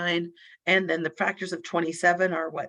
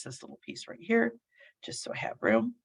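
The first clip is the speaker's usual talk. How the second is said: whisper; 5 semitones lower, 6 dB quieter than usual. Real, not acted. Aside while erasing the board.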